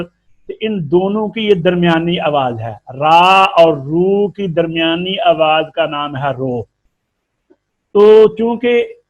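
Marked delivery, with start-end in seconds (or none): none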